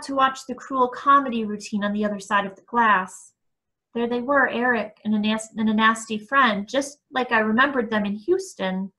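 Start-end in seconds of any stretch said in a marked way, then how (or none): none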